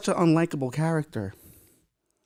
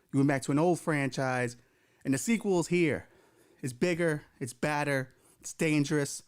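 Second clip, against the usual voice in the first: horror trailer voice; 5 dB quieter than usual. Acted. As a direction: build dread like a movie trailer. Dramatic sequel trailer intro.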